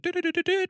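very high sing-song doo-doo sound; squeaky busy-typing noise; imitated googling noise. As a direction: tootle it high and quick.

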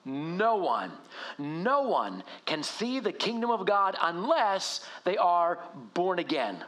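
slow, weighty reading of the verse; read together. Shown as a demonstration. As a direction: lean on each word, drawing out "no one". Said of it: "Say it with me".

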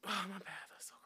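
whispered apology after a cough. Real, not acted. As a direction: breathe it out under your breath.

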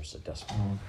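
ordinary delivery

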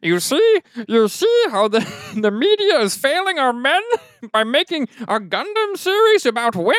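squeaky high mock-crying pundit; helium-voiced manosphere guy. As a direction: whine it way up high. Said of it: "he sounds like he took a hit of helium".

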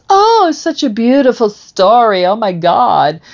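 high, loud mock gush; hammed-up swooning over a straight love story; gushing onlookers. Mocking how everybody gushes over straight couples' love stories, high and loud.

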